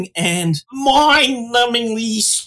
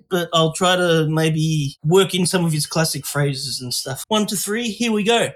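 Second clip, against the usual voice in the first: normal talking voice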